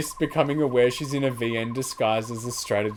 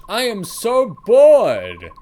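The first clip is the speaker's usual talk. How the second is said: whiny complaint; 8 semitones higher, 9 dB louder, much slower than usual. Acted. Bored character complaining.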